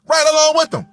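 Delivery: full-volume preacher repeat; shouted repeat. Real, not acted. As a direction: yell "Right along with them" back at the crowd.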